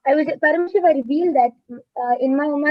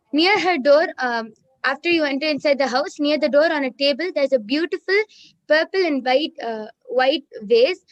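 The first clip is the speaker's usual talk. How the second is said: ordinary talk, nothing marked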